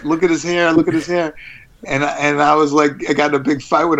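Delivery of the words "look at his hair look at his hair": lightly imitated taunt, pitched up; higher-pitched mock jeer; mocking kid. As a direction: repeat it like a teasing kid. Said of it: "he was making fun of".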